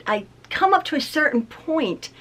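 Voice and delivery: ordinary talk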